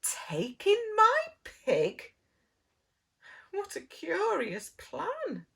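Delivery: astonished whisper. Acted. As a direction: breathe it out in disbelief. Old lady astonished whisper.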